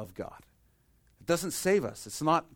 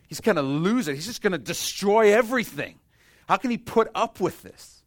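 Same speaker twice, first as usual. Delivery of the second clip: higher, louder panicked worry; alarmed creation; 8 semitones higher, 8 dB louder than usual. Acted. Creation dreading God's wrath, loud and alarmed. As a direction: voice the universe bracing in fear.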